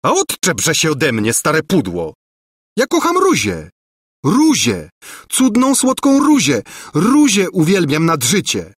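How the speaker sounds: high, impassioned outburst; desperate cry. A narrator's reading. Prince declaring love for another, crying out. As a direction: shake her off, then declare your love in high cries. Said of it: "krzyknął lulejka".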